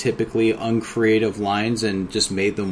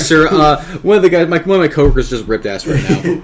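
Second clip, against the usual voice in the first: much higher and louder mock customer-service apology; coworker apologizing; 6 semitones higher, 9 dB louder than usual. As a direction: ham up the phone-support voice confessing.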